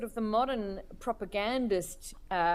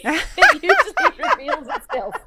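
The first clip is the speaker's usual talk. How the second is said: real laughter, much higher and louder, slowed down; laughing; 14 semitones higher, 13 dB louder than usual. Real, not acted. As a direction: laugh through the punchline. Joke about bad Russian accent.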